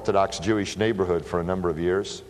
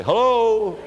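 calling out; 12 semitones higher, 5 dB louder than usual. Retold or imitated. Calling into house with robber.

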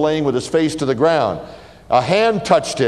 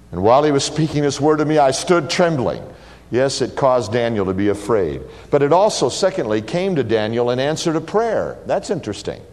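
normal talking voice

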